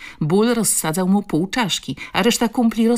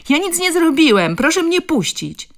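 very high, loud protest; defiant cry; 12 semitones higher, 8 dB louder than usual. A narrator's reading. Bully held by the ear to the woman, defiant cry. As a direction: call out indignantly, demanding release. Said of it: "zawołał chłopak buńczucznie".

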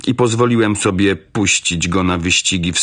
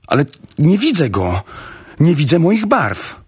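higher-pitched, worried colonel; agitated exclamation; 6 semitones higher than usual. A narrator's reading. Agitated colonel cannot see his horse. (wzburzony okrzyk, wyższym głosem)

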